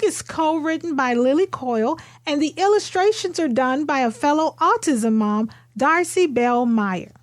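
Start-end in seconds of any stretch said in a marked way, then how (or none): none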